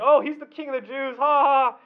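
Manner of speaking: high-pitched jeer with a fake laugh; sneering soldiers' taunt, ending on ha-ha; mocking. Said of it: "So they're mocking him, saying".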